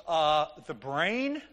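"brain?" rising sharply, as if unsure; questioning answer. Retold or imitated. Student answers the teasing questioner, "brain?" lifted as a question. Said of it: "Are you asking me a question?".

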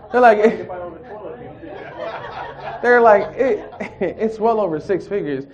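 loud, high and laughing; can't get the words out for laughing; laughing through words. Real, not acted. Laughing while retelling offer.

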